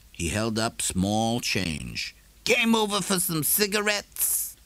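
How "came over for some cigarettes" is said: a neighbour challenging a man in a dark shop; Morrison's voice. A narrator's reading.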